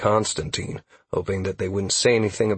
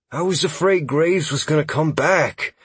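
higher, sheepish elf voice; timid, higher-pitched confession; shameful admission; 7 semitones higher than usual. A narrator's reading. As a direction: confess it, ashamed. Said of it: "Huxley admitted shamefully".

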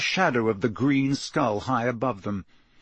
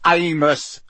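raised, firm call; loud interjection; 4 semitones higher, 5 dB louder than usual. A narrator's reading. Mister Weasley interrupts Amos's accusation, loud and firm. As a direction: cut in loudly on the name. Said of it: "said Mister Weasley loudly".

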